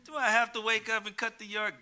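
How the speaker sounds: put-on high, loud kid's whine; whining child. Preacher imitating a child begging out of chores, whining. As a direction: plead like a child told to clean up. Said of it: "Some children".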